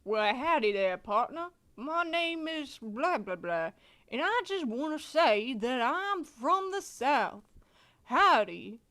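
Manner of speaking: folksy cowboy Southern accent. Shown as a demonstration. Streamer auditioning a character voice on request, Southern accent. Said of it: "Can you do a Southern accent?".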